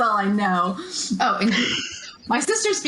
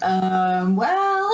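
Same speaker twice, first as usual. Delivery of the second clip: hesitant hedging. Retold or imitated. Mock parent answering a child who won't leave, hesitant.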